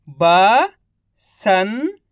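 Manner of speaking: each syllable drawn out very slowly; slow syllable-by-syllable reading. Shown as a demonstration. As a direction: sound out सुरंग slowly as सु-रं-ग, one sound at a time. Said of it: "एक अक्षर का उच्चारण करके".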